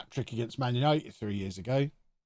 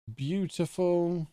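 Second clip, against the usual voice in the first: slow, higher drawn-out praise; savoured praise; 6 semitones higher, much slower than usual. Real, not acted. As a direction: stretch it out with relish. Savouring a done deal.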